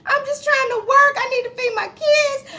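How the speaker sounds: mock boo-hooing. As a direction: imitate her sobbing desperately. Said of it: "she just started boo-hooing and crying".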